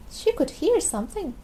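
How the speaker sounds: ordinary delivery, nothing marked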